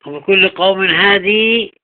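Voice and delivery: هادي finished with a long "ee"; slow recital, yaa sounded at the end of هادي; final yaa stop. Shown as a demonstration. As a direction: say haa-dee for هادي, holding the last vowel. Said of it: "بالياء".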